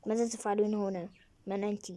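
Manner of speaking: ordinary delivery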